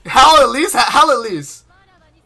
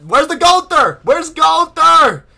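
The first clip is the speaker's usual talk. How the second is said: higher, louder and slow, stretching each word; desperate asking; 7 semitones higher, 6 dB louder, slower than usual. Real, not acted. Streamer asks the game where his unit is, desperate. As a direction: plead for the unit to show up.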